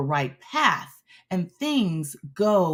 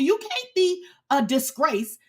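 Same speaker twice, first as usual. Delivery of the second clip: normal talking voice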